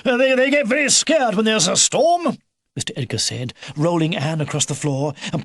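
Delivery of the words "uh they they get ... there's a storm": put-on urgent old helper. A narrator's reading. Mister Edgar urging the children to hide the hedgehogs, raised and urgent. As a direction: hurry the others along.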